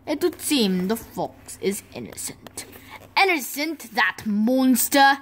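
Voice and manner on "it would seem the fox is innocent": hushed whisper. Acted. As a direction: whisper it aside. Aside, fox innocent.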